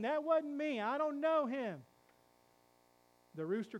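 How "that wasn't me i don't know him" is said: Peter denying. Acted. Peter still denying, louder.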